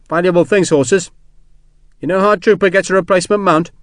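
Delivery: casual soldier's chatter to keep her from crying; chatty soldier Sharp. Acted. Soldier chatting to a shaken woman about horses, light chatty voice.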